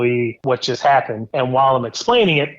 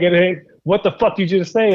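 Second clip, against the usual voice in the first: angry yell; 5 semitones higher than usual. Retold or imitated. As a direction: demand an answer, furious. Confronting the man who insulted him.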